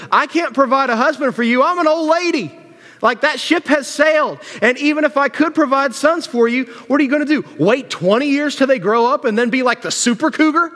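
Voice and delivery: put-on high-pitched, exasperated old lady protest; Naomi. Old widow telling daughters-in-law she has no sons, upset. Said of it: "Do you see how upset Naomi is".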